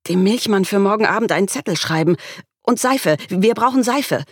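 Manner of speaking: high, hurried list of chores; speaking fast. A narrator's reading. Mother listing errands before leaving, fast and frantic. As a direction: rattle it off, climbing in pitch. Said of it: "Ich begann, schnell zu sprechen".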